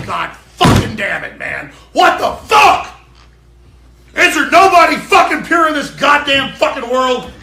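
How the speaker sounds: enraged full-voiced shouting, pitch jumping high; loud, high, furious cursing; real furious outburst. Real, not acted. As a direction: explode in exasperated rage, hammering every swear word. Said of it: "now I'm fucking pissed right now".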